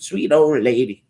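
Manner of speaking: put-on high, sweet old-lady voice. Shown as a demonstration. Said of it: "when I think of sweet old lady".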